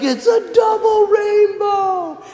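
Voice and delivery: high, slow, tearful shout of wonder; overwhelmed hippie weeping at a rainbow; crying hippie. Retold or imitated. Hippie camper sees a double rainbow, high tearful shout. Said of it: "and he's crying".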